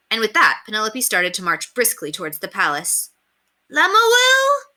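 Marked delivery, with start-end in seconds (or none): 3.7-4.7 put-on very high, loud childish cry; alarmed child call. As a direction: call after her in alarm. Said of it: "the children called in alarm".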